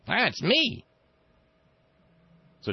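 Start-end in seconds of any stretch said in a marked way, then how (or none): 0.1-0.8 much higher pleased little answer; mock Rumsfeld. Rumsfeld answering Cheney, in a high mock voice. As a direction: chirp it out proudly. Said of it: "And Rumsfeld says".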